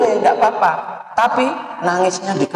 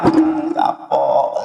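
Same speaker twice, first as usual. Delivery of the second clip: mocking gloat; 9 semitones higher, much slower than usual. Friends mocking the one who cried.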